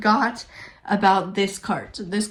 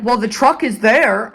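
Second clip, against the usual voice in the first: louder, snotty imitation of the clerk; mock the rude clerk's dismissive tone; 9 dB louder than usual. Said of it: "if that guy wasn't rude".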